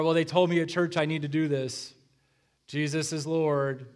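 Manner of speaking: half-hearted dutiful churchgoer; bored, uncaring. Retold or imitated. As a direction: drone it like you don't care, slowing on Jesus is Lord. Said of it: "Do we actually sound like we care about it?".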